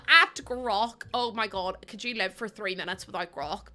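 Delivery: normal talking voice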